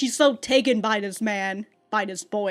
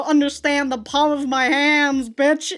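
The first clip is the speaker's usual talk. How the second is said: higher, louder hammed-up threat, laughing at the end; mock threat; 4 semitones higher, 6 dB louder than usual. The viewer imagines Aoya threatening Kuze before the slap, hammed-up. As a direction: play it like a horror-game heroine snapping.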